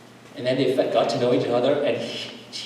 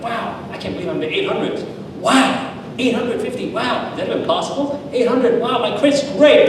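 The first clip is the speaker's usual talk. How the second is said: much higher, gushing wows; mock amazement; 8 semitones higher than usual. Preacher mimicking someone thrilled at their credit score. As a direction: play a giddy, dazzled saver.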